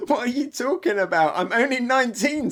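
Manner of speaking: high-pitched, put-out exclamation; indignant protest. Acted. Usopp objecting to being called old, indignant protest.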